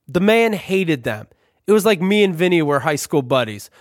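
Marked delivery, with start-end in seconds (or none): none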